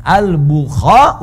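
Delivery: Al-Bukha stretched very slowly syllable by syllable; drawn-out name. Shown as a demonstration. Teacher demonstrating how the name Al-Bukhari is formed, very slow. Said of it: "ujungnya ganti dengan I".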